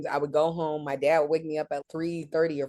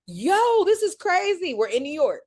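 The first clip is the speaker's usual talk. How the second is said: much higher and louder, thrilled; excited; 14 semitones higher, 6 dB louder than usual. Retold or imitated. Bumping into her co-host in New York, excited shout. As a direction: shout it out in happy disbelief. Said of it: "And I'm like".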